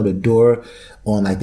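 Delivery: usual speaking voice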